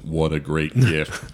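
slow, drawn-out imitation of a monotone; mock someone's toneless delivery; parroting a monotone, slowly; mimicked monotone. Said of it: "I like the monotone".

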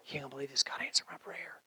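hushed, barely voiced amazement; awed whisper. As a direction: whisper it like an incredible secret. Acts out amazed answer to prayer.

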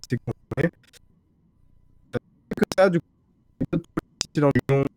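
glitchy robotic voice over a bad connection; choppy digital distortion, like a failing call. Real, not acted. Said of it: "tu as une voix de robot".

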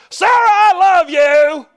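high, loud, drawn-out mock holler; out-of-place shout. Groom hollering to his bride at the wedding, mock shout. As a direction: bellow a love declaration, hammed-up. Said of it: "it's out of order to shout".

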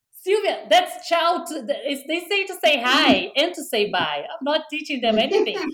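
high, indignant protest; friend's high indignant reply; defensive protest. Retold or imitated. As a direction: defend yourself with mock indignation. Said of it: "And she's like".

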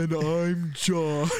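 very slow, drawn-out name; slow mock intro. Co-host introducing himself, very slow. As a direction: stretch the intro comically.

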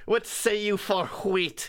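"wheat" said as "hwheat" with a breathy h; slow goblin voice, breathing the h in "wheat"; extra h sounds. Pretend. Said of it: "why are you pronouncing the H".

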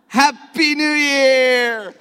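high, slow, loud party cheer; new year countdown crowd. Pretend. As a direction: cheer it out like midnight hit. Countdown ends, party shout.

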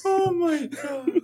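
slow high wail of laughter; real tears-of-laughter cry; crying laughing. Real, not acted.

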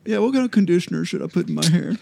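a hint of a girl asking about hair; man doing a woman's voice. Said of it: "So I'm talking like a girl now".

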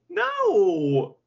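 a loud, high cry of disbelief; real cry of despair; anguished no. Real, not acted. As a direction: wail the word in protest. Anguished at Teton beating Kushida.

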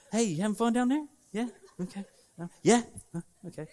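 playful, self-answering little questions; mock friendly check-in. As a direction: taunt them with pretend concern. Pretends calling down a well.

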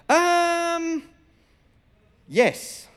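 long-held high um, then a short yes; awkward, reluctant confession; hesitant. Retold or imitated. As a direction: stall on a drawn-out high um before admitting it. Nervous answer to the boss.

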